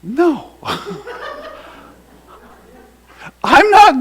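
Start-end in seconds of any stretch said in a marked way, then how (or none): 0.0-0.5 mock refusal. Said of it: "I'm looking at him and I'm going". 0.6-1.5 high laugh. Real, not acted. Laughing at his own story.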